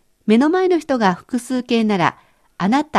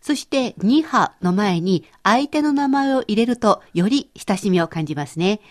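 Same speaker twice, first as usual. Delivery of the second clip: ordinary talk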